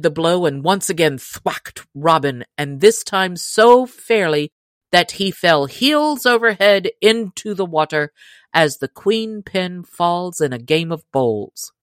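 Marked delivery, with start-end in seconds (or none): none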